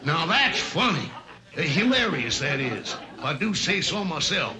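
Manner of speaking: put-on a cocky rooster needling a silent boy, high and blustery; Foghorn Leghorn. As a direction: boom it out like a pompous cartoon rooster holding court. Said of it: "Mister Foghorn Leghorn".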